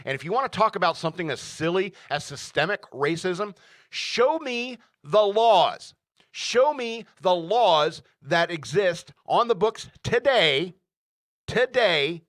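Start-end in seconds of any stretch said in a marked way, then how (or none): 3.9-12.2 loud, higher, slow and punchy demand; emphatic challenge. Real, not acted. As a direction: throw down the challenge, drawing out each word.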